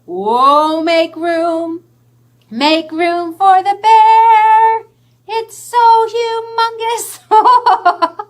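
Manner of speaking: high, loud, slow singsong; excited sing-song. Acted. Making room for the bear in the story, slow excited singsong. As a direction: stretch it out big and excited.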